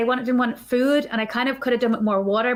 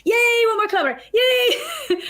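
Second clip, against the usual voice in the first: much higher, louder squeal of joy; celebrating; 13 semitones higher, 3 dB louder than usual. Retold or imitated. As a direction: cheer each kilometer like a little party. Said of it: "I'm celebrating at every kilometer".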